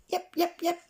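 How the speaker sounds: high yappy yep yep; little dog yaps. A narrator's reading. Hairy Maclary adds to the din, high yapping. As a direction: yap like a scruffy small dog.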